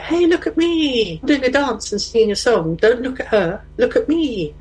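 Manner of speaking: put-on high, sing-out attention-seeking child; entertaining kid. Daughter drawing eyes away from her mum, entertaining kid voice. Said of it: "the entertaining kid. I'd be like".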